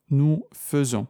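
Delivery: "nous faisons" with the "ai" of faisons said as a short "e" (fe-ZON), not "è"; exception pronunciation. Shown as a demonstration.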